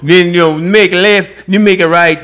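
put-on light Jamaican accent giving directions. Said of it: "I'm trying to find a Jamaican accent".

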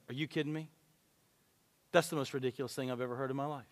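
low, soft incredulity; quietly acted-out incredulous rebuke; understated disbelief. Voicing Jesus's disbelief at the excuse.

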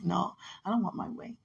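ordinary talk, nothing marked

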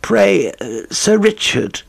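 lightly voiced courteous stranger interrupting; polite visitor. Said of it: "said a new voice".

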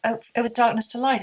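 ordinary talk, nothing marked

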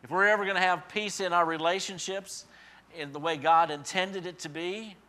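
emphatic preaching. Acted. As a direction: press the point home.

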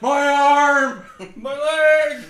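very high, loud, drawn-out comic wail; mock agonized yell. Storyteller mocking the injured man for friends, mock agonized yell. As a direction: ham up the injured man's howling.

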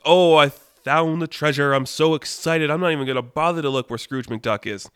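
stretched, excited "Oh" then eager rush. Acted. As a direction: call it out, thrilled by the find. Voicing excited Toucan Sam in story.

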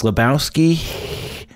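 slow "Lebowski" ending in a breathy hiss. Shown as a demonstration. Knox Harrington's drawn-out name with hiss. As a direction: stretch "Lebowski", let the "ski" hiss out. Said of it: "a little hiss at the end".

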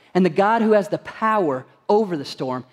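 ordinary talk

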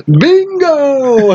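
very high, drawn-out bingo call; imitated triumphant yell. Recapper imitating Michael throwing the watermelon, yelling. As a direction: yell it high, half laughing.

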